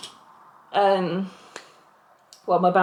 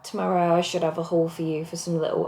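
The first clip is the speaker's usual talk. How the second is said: normal talking voice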